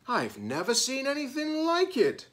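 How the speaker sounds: put-on high, wondering kid voice; a child in awe; amazed classmate. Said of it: "said another".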